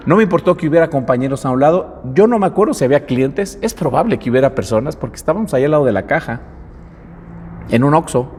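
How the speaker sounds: ordinary talk, nothing marked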